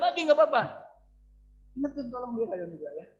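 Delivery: ordinary delivery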